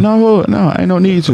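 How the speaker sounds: playful high-pitched no hug; high mock dismissal. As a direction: toss off a high, carefree no. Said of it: "I'm like".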